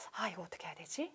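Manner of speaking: very soft, low wondering aside; puzzled self-talk. Acted. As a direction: murmur it to yourself as a stumped learner. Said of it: "고민되는 표현이죠".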